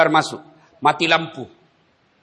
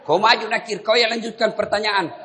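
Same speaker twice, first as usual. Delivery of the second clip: laughing nervous angel; 7 semitones higher than usual. Acted. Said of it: "dia bilang".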